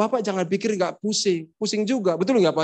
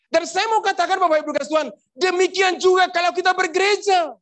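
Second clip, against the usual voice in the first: raised, emphatic pulpit voice, slowing on value; fervent preaching; 8 semitones higher than usual. Acted. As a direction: preach it out to the congregation. Preaching climax. (berkhotbah dengan semangat)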